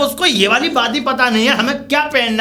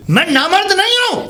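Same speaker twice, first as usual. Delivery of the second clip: higher, slow, indignant denial; angry denial; 5 semitones higher than usual. Acted. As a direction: drag the denial out in wounded anger. Husband rejects wife's insult.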